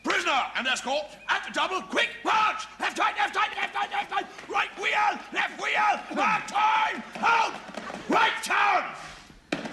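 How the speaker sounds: parade-ground commands, very high and loud; drill shouting. Acted. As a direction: bark marching orders.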